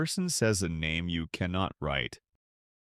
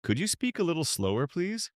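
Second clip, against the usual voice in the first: quick model answer; 8 semitones higher than usual. Shown as a demonstration. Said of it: "Let us do a fast round".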